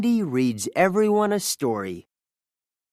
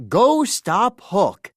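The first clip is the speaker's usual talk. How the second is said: loud, slow and higher command; urgent command; 5 semitones higher, 5 dB louder, slower than usual. A narrator's reading. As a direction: push them to go after Hook. Policeman urging the heroes on.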